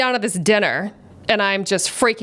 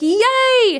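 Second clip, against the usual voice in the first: high-pitched, drawn-out squeal of delight; mock cheer; 14 semitones higher, 4 dB louder than usual. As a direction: cheer as if for the cameras. Retold cheer for special guest.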